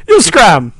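loud fake Italian bark; terrible Italian accent. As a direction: snap it in hammy Italian. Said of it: "terrible Italian imitation".

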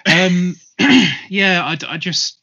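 clearing throat after choking; strained voice recovering from a cough; choking on a drink. Real, not acted. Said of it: "just had a swig of water".

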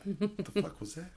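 alarmed in the dark woods; mock spooked. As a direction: camper startled by a noise in the woods. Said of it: "you're like".

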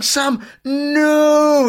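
long, drawn-out high "no"; mock-horrified slow-motion cry, stretched right out; slow-motion shout. Viewers watching the interview go wrong, a slow-motion wail. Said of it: "in slow motion".

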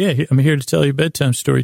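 ordinary delivery

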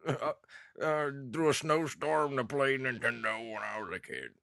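high, put-on geezer voice; old man. Podcaster joking about his hard childhood, mock old man. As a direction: spin a tall tale as a hammed-up old-timer. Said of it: "I sound like an old man now".

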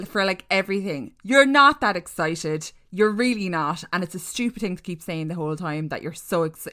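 ordinary talk, nothing marked

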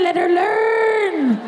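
high, very slow, stretched-out cry; high wailing let her learn; drawn-out plea. Retold or imitated. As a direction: drag the word learn out in pain. In agony, urging the student nurse.

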